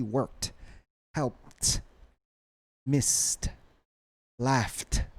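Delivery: "worked, helped, missed, laughed" each ending on a released t; final T sound. Shown as a demonstration. Teacher listing examples, final T on "worked, helped, missed, laughed". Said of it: "these have some final T sound".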